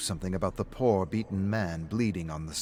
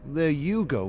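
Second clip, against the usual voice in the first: raised, louder needling jab, stress on you; mocking friend; 6 semitones higher, 6 dB louder than usual. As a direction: throw it back at him. [name] taunts David in bar.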